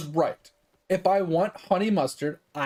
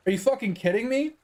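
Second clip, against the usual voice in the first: normal talking voice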